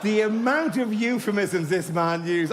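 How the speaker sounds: ordinary delivery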